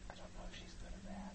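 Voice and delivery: very soft, voiceless aside; whisper. Real, not acted. Player unsure about a game figure, whispered. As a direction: whisper it warily. Hushed doubt in horror game.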